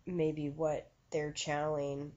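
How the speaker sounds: normal talking voice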